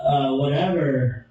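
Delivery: ordinary talk, nothing marked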